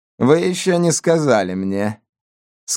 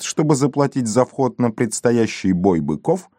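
ordinary delivery, nothing marked

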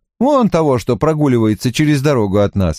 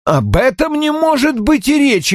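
high-pitched indignant objection; categorical, high-voiced protest; emphatic refusal. A narrator's reading. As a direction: reject it outright, pitch raised. O'Neill refuses the counter-offer.

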